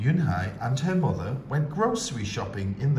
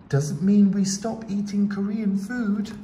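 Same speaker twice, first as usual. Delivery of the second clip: higher, gentle mom voice; mother voice; 9 semitones higher, 4 dB louder than usual. A narrator's reading. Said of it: "her mother said".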